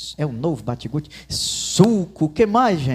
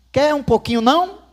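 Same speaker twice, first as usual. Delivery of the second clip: higher, louder offer that pushes the "não?" up at the end; grudging offer; 8 semitones higher, 4 dB louder than usual. Retold or imitated. A half-hearted brother offering food he hopes is refused. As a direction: offer it so they will say no. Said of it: "oferecem pra gente não aceitar assim".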